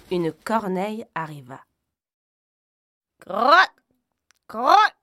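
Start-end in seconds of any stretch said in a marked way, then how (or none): 3.2-4.9 high cawing croak, rising each time; loud rising crow's caw. A narrator's reading. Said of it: "cria l'oiseau".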